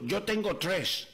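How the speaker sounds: slow, drawn-out gringo Spanish; the American buddy slowly mangling Spanish; American speaking Spanish. Acted. American friend proposes duck hunting, in slow gringo Spanish. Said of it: "Y el americano le dijo".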